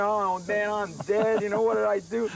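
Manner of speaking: high-pitched mock alarm; worked-up, comically worried high voice; comic panic. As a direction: retell being in big trouble. Retold panic at woman calling.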